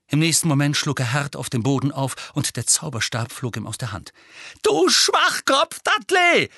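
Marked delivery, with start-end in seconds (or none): 4.6-6.5 high, loud, slow furious yell; shouting. A narrator's reading. Harry, knocked down, screams at Dudley. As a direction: yell in pain and anger. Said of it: "schrie Harry".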